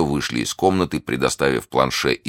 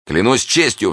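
higher-pitched earnest exclamation; cried oath; 8 semitones higher, 3 dB louder than usual. A narrator's reading. D'Artagnan rushing to the dying man, crying out. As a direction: swear it with urgency. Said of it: "вскричал Д'Артаньян".

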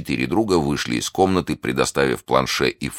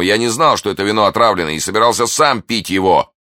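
raised, earnest pleading; urgent protest; 6 semitones higher, 5 dB louder than usual. A narrator's reading. D'Artagnan swearing innocence to dying Brisemont, urgent protest. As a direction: insist on innocence, emphatic. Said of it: "вскричал Д'Артаньян".

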